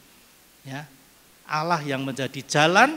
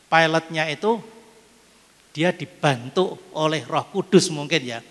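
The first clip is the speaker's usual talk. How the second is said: usual speaking voice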